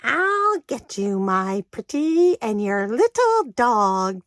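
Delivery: high, slow, cackling-witch menace; Wicked Witch voice. Retold or imitated. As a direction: sneer it out like the Wicked Witch of the West. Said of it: "I didn't do my Wicked Witch voice".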